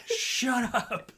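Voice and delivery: high-pitched exasperated mock shout; triggered mock yell. Triggered person responding to teasing, high mock yell. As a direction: snap like someone easily provoked. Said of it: "the response to something like".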